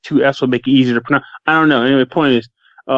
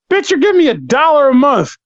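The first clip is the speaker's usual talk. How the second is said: rising indignant rant, pitched way up; mock outraged rant; 9 semitones higher than usual. Ranting at a pushy patron, mock outraged. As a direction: scold him, getting higher and higher.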